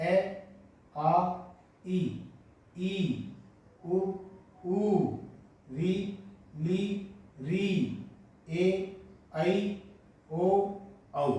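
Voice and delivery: each vowel said clearly with short pauses; teacher sounding out vowels; vowel pronunciation demo. Shown as a demonstration. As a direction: model every vowel for students to copy. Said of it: "इनका उच्चारण आप देख लें एक बार".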